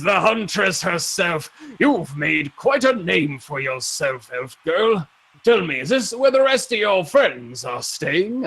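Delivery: big, hearty, drawn-out greeting; boisterous old champion. Acted. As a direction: hail her like a proud arena veteran. Voices champion Ramses.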